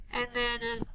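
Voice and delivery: normal talking voice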